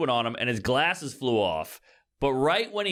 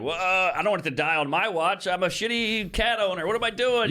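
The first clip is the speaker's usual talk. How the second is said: panicky; 6 semitones higher than usual. Retold or imitated. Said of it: "You kind of have that panic hit you".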